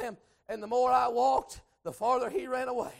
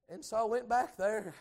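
usual speaking voice

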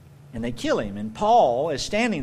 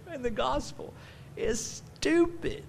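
mock sneer; 10 semitones higher, 6 dB quieter than usual. Mocking the enraged crowd.

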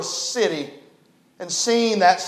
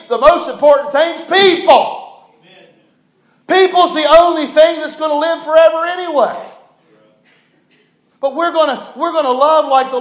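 loud, raised preaching cry, slowing on the first line; preaching shout; 6 semitones higher, 5 dB louder than usual. Real, not acted. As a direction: proclaim it to the whole room at full force. Preacher hammering his main point.

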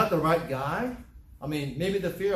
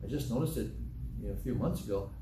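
ordinary talk, nothing marked

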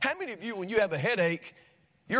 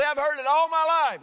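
much higher, louder whiny voice; mocking complainer; 10 semitones higher, 5 dB louder than usual. Preacher mimicking a churchgoer bored with sermons, whiny mock complaint. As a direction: toss off the tired complaint. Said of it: "You say".

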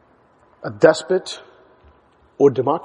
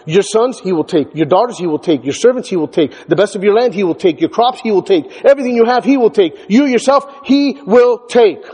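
each take landed with a falling stress, building higher to the end; emphatic reading; 4 semitones higher than usual. Shown as a demonstration. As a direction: hammer every he will take. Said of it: "the Hebrew text reads emphatically".

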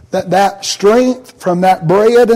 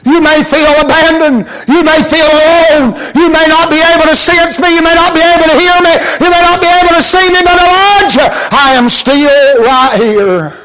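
higher, fast, then slowing on the promise; impassioned preaching; 8 semitones higher, 3 dB louder than usual. Acted. God reassuring the lonely Elijah through the preacher, impassioned.

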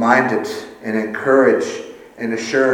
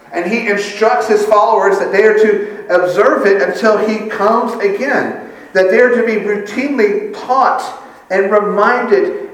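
higher, louder preaching at full force; impassioned preaching; 9 semitones higher, 4 dB louder than usual. Real, not acted. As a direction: drive the message home from the pulpit.

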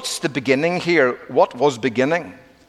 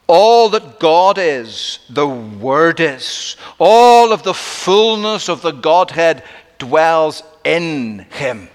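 emphatic preaching; 2 semitones higher, 5 dB louder, slower than usual. Acted.

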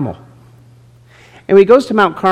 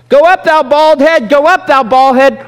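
much higher, louder sing-song taunt; jeering children; 11 semitones higher, 6 dB louder than usual. Retold or imitated. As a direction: jeer it like a pack of mean kids. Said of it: "They mocked him and they said".

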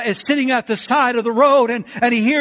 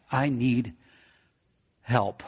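softer, lower, drawn out "I need help"; hushed desperate plea; 8 semitones lower, 8 dB quieter, slower than usual. Acted. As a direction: breathe it like a last hope. God's people pleading for help.

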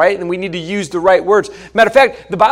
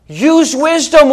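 usual speaking voice

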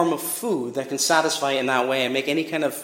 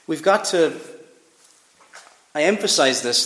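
usual speaking voice

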